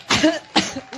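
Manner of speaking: short dry cough; real cough; coughing fit. Real, not acted. Coughs through her speech.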